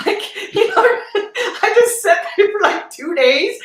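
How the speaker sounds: high laughing through the words. Real, not acted. Laughing while telling the interviewer about the halfway house.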